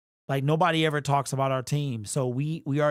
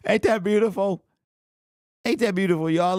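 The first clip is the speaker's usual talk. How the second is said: delighted laugh; 7 semitones higher than usual. Real, not acted. Delighted at standings.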